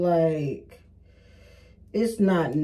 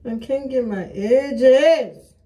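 slow, higher, laughing through the words; laughing in despair; 6 semitones higher, 5 dB louder, much slower than usual. Real, not acted. Laughing at her own edges, slow and higher. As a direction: laugh it out, can't believe it.

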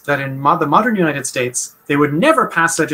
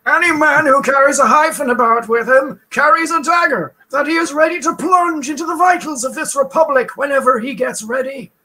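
Woodrow Wilson impression; 11 semitones higher than usual. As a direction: declaim like a fiery old president. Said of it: "despite this stupid accent I'm doing".

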